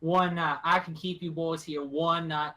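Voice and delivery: lightly voiced rural host, reluctant; grudging country woman. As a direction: offer shelter grudgingly, in country talk. GM voices gun-toting woman.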